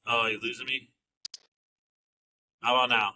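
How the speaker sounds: choppy, cutting-out call audio; bad connection, words dropping out; breaking up. Real, not acted. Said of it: "You're cutting out pretty bad".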